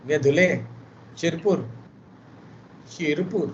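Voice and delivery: usual speaking voice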